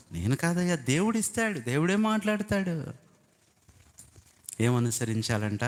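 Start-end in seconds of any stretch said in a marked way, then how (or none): 0.1-2.8 a hint of Joseph's humble insistence, higher; Joseph answering Pharaoh. Joseph telling Pharaoh that God gives the answer, lightly voiced. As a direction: lift it to God, not yourself. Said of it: "అదే అన్నాడు ఫరో యోసేపుని పిలిచి".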